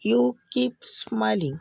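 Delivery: very slow, each word spelled out; slow teaching demo. Shown as a demonstration. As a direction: say it slowly for learners to copy. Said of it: "को English में बोलेंगे". (धीमे-धीमे, साफ़ उच्चारण में)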